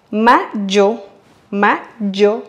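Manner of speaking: mayo with the Y as a buzzing zh, ma-zho; strong Y. Shown as a demonstration. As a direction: say mayo with a hard zh sound. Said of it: "d'une façon plus forte".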